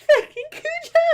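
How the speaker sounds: high, loud, laughing curse. Real, not acted. Player cursing Cujo through laughter. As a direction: blurt it mid-laugh.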